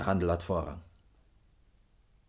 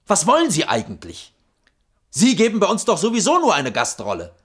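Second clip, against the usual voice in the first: angry; 7 semitones higher, 5 dB louder than usual. Acted. Boss rebukes the architect.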